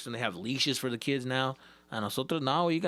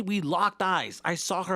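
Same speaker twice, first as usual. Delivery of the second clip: normal talking voice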